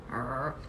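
low, soft throaty growl; angry dog growl. Shown as a demonstration. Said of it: "Angry dog".

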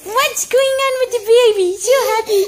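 put-on high, sing-song cooing to a baby; mother cooing at her laughing baby; baby talk. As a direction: coo at the giggling baby, bright and lilting.

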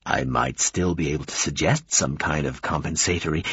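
low, formal offer; conciliatory. Acted. Formal visitor tries again.

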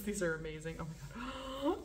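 ordinary talk

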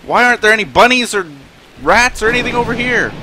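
high, loud urgent pleading; frantic search. Real, not acted. Streamer hunts desperately for prey to feed the cub, frantic. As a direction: rush it in worried desperation.

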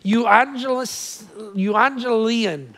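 euangelion sounded out slowly; careful, drawn-out euangelion; phonetic pronunciation. Shown as a demonstration. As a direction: say euangelion slowly, like teaching a Greek word. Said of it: "Phonetically, it goes something like this".

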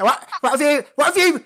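high, loud mock outrage; retold outraged teacher. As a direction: act out a shocked teacher, voice climbing high. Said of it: "And he's like, he's like".

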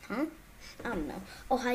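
normal talking voice

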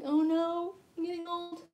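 high, whiny mock dismay; mock stressed-out worry. Woman mocking her own worry about getting old, mock distress. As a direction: fret in a silly high voice. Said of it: "I let that stress me out".

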